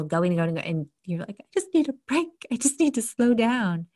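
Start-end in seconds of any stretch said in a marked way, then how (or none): 1.4-3.9 high-pitched plea for relief; strained-to-the-top, overwhelmed self-talk; mock overwhelmed plea. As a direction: act out someone begging for a breather. Acted overwhelmed plea for a break.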